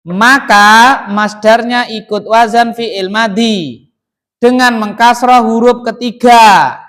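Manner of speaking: loud, measured dictation; rule read-out. Shown as a demonstration. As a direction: proclaim the rule slowly for the class. Reads out the rule.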